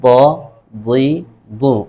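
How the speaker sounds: ضا ضی ضو drawn out slowly, one vowel each; letter sound demo. Shown as a demonstration. Quran teacher demonstrating letter sounds to students, slow and clear. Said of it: "لیکن اس کی آواز کیا ہے؟".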